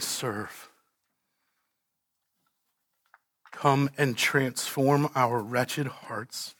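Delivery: soft, low, earnest prayer; closing prayer. Acted. Pastor leading the church in closing prayer, hushed.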